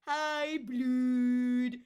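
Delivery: drawn-out loud greeting. Real, not acted. Greeting returning chatter.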